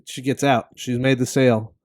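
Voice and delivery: usual speaking voice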